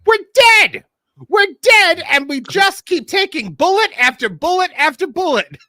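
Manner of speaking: high, loud desperate cry; Paul Heyman shout. Retold or imitated. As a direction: yell it like a doomed promoter. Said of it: "when Paul Heyman just said".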